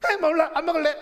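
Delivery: high, loud whiny pout; sulky childish king. Acted. King refusing his wife's plea to eat, childish sulk. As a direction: whine like a stubborn child refusing food. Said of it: "밥을 안 먹고 있어요".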